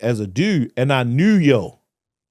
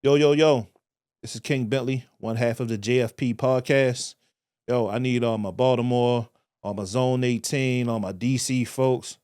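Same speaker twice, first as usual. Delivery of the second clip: laid-back, softer promo read; podcast shout-out; 6 dB quieter than usual. Acted. Host asks listeners to like and share, laid-back promo. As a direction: plug the show to the listeners.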